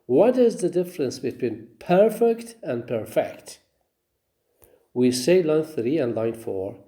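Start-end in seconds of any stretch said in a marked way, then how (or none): 1.8-3.6 first perfect as PER-fect, second perfect as per-FECT; contrasting syllable stress. Shown as a demonstration. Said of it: "the first one receives stress".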